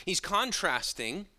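ordinary delivery

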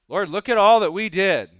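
loud, high, pleased with themselves; proud boast to the Lord. Pretend. Levites calling out to the Lord for credit, loud and proud. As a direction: shout it up to God, wanting credit. Voicing Levites expecting reward.

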